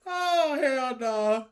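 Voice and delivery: loud, slow, drawn-out disbelief; excited groan. Real, not acted. Gamer reacting to his pull, loud disbelief. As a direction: stretch out the oh in shock.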